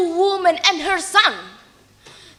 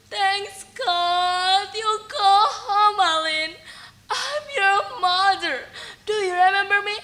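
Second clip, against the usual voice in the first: crying; 6 semitones higher, 4 dB quieter than usual. Acted. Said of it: "She cried".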